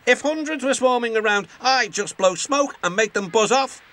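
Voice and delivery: high, quick boast; bragging swagger. Acted. James brags he'd blow the bees away, swaggering. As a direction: puff yourself up.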